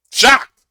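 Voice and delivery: Shocked burst out high and loud; exclaimed. Real, not acted. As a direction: fling out the word Shocked. Stressing the bystander's shock.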